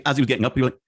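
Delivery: ordinary talk, nothing marked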